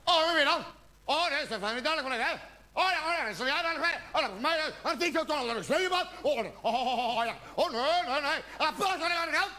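high-pitched, hammed-up interrogator gabble; Gestapo interrogation. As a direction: grill her in a high, relentless mock interrogation. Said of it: "onderwerp je je vriendin aan een Gestapo-verhoor". (opgefokt, hoog Gestapo-verhoor)

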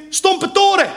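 high, loud parent's call; calling a child's name. Acted. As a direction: holler the place name as a name. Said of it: "Hoe heet je kind?".

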